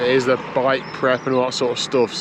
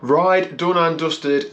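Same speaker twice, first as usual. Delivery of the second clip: drawn-out catchphrase; 5 semitones higher, much slower than usual. Acted. Catchphrase opening the ride recap.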